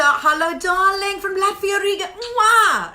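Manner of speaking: high, loud showbiz hello; effusive welcome ending in a big kiss; gushing greeting. Real, not acted. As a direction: greet a fan as darling, loudly.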